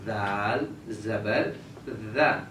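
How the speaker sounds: دال زبر spelled very slowly, ذا clean; slow spelling demo. Shown as a demonstration. Teacher slowly spells the letter for the child. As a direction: spell it slowly, tongue tip to the upper teeth. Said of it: "زبان کی نوک اور اوپر سامنے والے".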